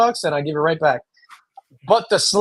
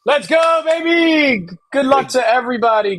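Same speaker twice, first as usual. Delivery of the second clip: excited shout; 9 semitones higher, 3 dB louder than usual. Real, not acted. Hyping up the spin.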